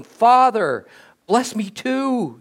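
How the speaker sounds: high, loud cry to a father, mildly tearful; loud, bitter plea. A narrator's reading. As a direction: read as an anguished beg, drawing out the last words. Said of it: "burst into a loud, bitter, sobbing".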